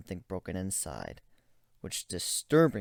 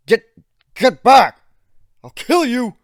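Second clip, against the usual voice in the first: higher, much louder frantic yells; panicked shouting; 14 semitones higher, 19 dB louder than usual. Acted. Ivan screaming at the approaching narrator. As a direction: scream to keep him away.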